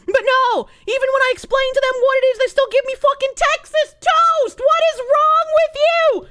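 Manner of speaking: rising indignant rant; getting angry. Real, not acted. Complaining to chat about Southern sub shops, rising anger. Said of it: "I'm getting angry".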